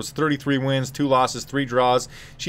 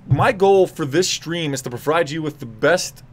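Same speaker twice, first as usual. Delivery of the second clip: ordinary delivery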